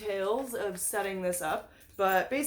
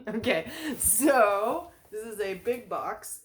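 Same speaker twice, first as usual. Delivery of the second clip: ordinary talk, nothing marked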